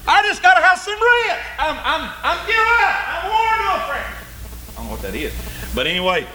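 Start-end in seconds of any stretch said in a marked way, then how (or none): 0.1-4.1 weary complaint. Retold or imitated. Tired everyman begging for rest, high hammed-up whine. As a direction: whine like someone worn out. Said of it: "every now and then you say".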